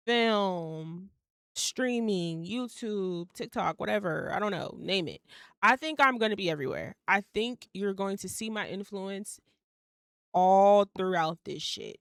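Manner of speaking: ordinary delivery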